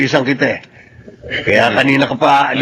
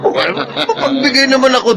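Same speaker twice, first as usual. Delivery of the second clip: laughing; 9 semitones higher than usual. Acted. Magno laughs defending himself.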